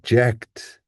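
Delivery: "ject" hit high and full; power syllable. Shown as a demonstration. Teacher isolating the power syllable, strongly stressed "ject". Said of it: "power syllable".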